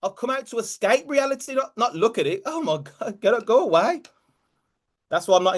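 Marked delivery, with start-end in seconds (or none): 0.0-4.0 high-pitched acted-out recoiling; hammed-up high complaint; mock dismay. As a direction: protest like someone dodging the truth, voice pitched up. Acting out friends dodging the truth.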